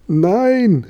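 very high, drawn-out exclamation; high cry. A narrator's reading. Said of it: "rief der Josef".

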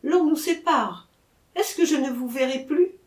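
put-on a young girl torn from her beloved; with emotion. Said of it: "dit-elle avec émotion". (avec émotion, voix plus aiguë et lente)

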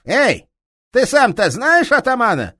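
angry detective shouting down a thief; enraged police inspector. Acted. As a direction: roar at the thief with fury.

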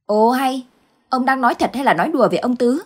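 wife brushing off her husband's nonsense; incredulous wife. Acted. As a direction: toss it off, amazed. Wife exclaims in disbelief.

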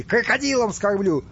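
high-pitched shouted threat; furious yell. Acted. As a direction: threaten in a rage. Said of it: "заорал Неменхотеп в ярости". (в ярости, с угрозой)

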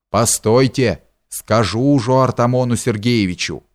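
usual speaking voice

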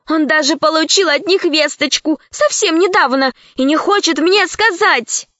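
put-on high indignant girl voice. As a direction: accuse like an upset child. Lara accusing Vodechka.

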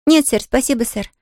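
put-on a lively little girl. A narrator's reading. Pollyanna voiced by reader.

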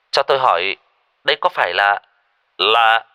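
put-on hesitant phone stranger, off-accent Vietnamese; halting non-native Vietnamese accent. A narrator's reading. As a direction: stumble through Vietnamese with a foreign accent, loudly. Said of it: "giọng nói lơ lớ tiếng Kinh".

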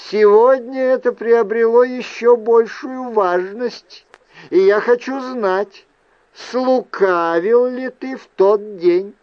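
high-pitched, insistent questioning as Fiona; insistent aunt. Acted. Aunt wants to know whether nephew lied, insistent. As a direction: demand the truth, voice held high. Narrator voices Fiona demanding truth.

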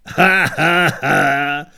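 loud, mocking spoken ha ha ha; sarcastic laugh. Podres mocks Kohen's talk of descendants with a loud, sarcastic ha ha ha. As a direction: sneer each ha at him. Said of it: "pronesl jízlivě Podrs".

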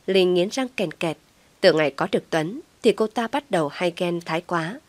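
usual speaking voice

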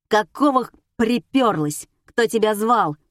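loud, hostile questioning; angry confrontation. A narrator's reading. Alisa demands why Oksana turned up, angrily. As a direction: lay into the uninvited guest.

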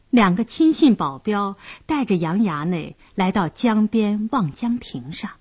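ordinary delivery, nothing marked

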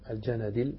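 الجندل said al-ja-na-dil; three syllables after the article in الجندل, ja-na-dil; fatha nun, kasra dal. Shown as a demonstration. Teacher repeats the vowelling for students, fatha and kasra in الجندل. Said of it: "بفتح النون وكسر الدال".

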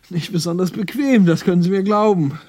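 loud, high, over-emphatic assurance; hammed-up assurance, pitched high and loud; rehearsing for police. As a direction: practise the line at full projection. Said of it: "Er übte schon für die Polizei".

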